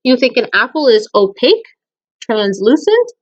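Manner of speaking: ordinary delivery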